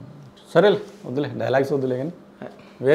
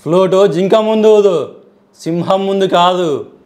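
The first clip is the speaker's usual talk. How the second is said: slow, punchy mass-hero line, higher and louder; Balakrishna dialogue; 3 semitones higher, 5 dB louder, slower than usual. Retold or imitated. Guest shows off a Balakrishna punch dialogue, slow and booming. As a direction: deliver it like a roaring film hero, slow. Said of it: "Balakrishna dialogue చెప్తా".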